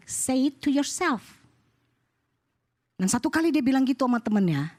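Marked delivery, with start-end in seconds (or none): 0.1-1.4 tag. Shown as a demonstration. Father coaches child's comeback line.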